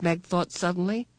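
ordinary delivery, nothing marked

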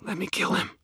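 high moaning hungry plea; moaning vampire. Acted. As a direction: moan with hunger. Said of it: "moaned the female".

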